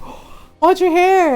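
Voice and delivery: high, loud, slow, wide-eyed want; envious gasp. Retold or imitated. To her ninety-eight-year-old grandpa, envious excitement. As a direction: gasp then plead for it. Gasping envy at grandpa's hair.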